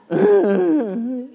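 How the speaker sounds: high, loud fake whimpering; imitated child's whimper. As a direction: whimper like a kid after attention. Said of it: "the whimper".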